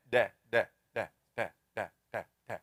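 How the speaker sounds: "da" said voiced, with no air; wrong da sound. Shown as a demonstration. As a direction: show the wrong voiced "da" with no puff. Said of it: "it will be almost dal".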